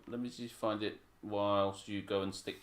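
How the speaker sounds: ordinary talk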